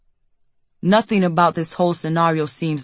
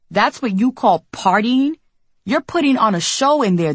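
angry; 5 semitones higher than usual. Acted. Quarrel with Darius.

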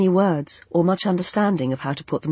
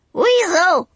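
high shrill squawk, much louder; squawking bird; 6 dB louder than usual. A narrator's reading. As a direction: squawk it like a big seabird. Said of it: "it squawked".

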